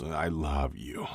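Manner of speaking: slurred, slow and soft, words half-lost; garbled. A narrator's reading. Ilya climaxing, slurring his love to Shane, garbled and soft. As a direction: let it tumble out blurred and slow. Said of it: "coming hard with a garbled".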